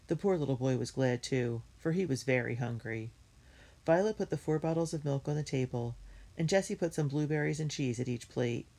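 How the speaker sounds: ordinary delivery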